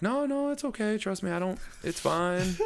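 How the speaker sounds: very high, strained mock reassurance; hammed-up high embarrassed backpedalling; awkward reassurance. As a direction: squeak it out, insisting all is well. Retold awkward reassurance to the lady.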